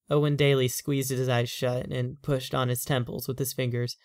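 usual speaking voice

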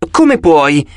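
exclaiming. A narrator's reading. Annabeth reproaches her mother Athena, exclaiming. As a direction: object with a touch of disbelief. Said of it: "esclamò Annabeth".

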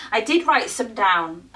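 usual speaking voice

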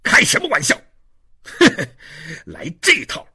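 loud, derisive swings in pitch; scornful jeering. Acted. Inspector mocking the prisoner's request, scornful. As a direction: scoff at a stupid trick.

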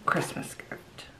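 soft secret whisper. Real, not acted. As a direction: whisper so no one hears. Gift talk near wrapping.

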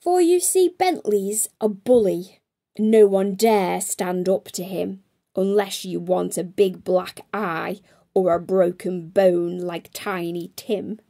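ordinary delivery, nothing marked

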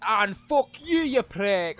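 high, loud burst of abuse; angry shout. Real, not acted. Streamer cursing someone he runs over, angry shout. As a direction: yell it high and hard.

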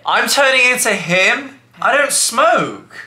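high, slow, offended protest; mock indignation. Boyfriend compared to the sad guy meme, mock indignation. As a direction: object in a drawn-out, high incredulous voice.

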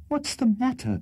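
very high mock scream; a high-pitched cry of alarm, not full volume; screamed question. A narrator's reading. Ladies to the host about the accident, screaming. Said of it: "screamed the ladies".

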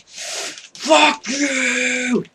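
very high screamed curse; angry scream. Real, not acted. Gamer blown up by a creeper, very high angry scream.

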